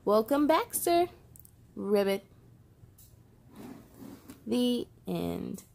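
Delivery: lively picture-book read-aloud with voiced characters; reading to children. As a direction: bring each rainy-day page to life for little listeners.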